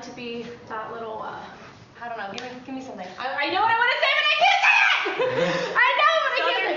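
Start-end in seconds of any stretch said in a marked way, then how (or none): 3.2-6.8 high, loud laughing outburst; laughing frustration. Real, not acted. Laughing, stuck for words in a confessional.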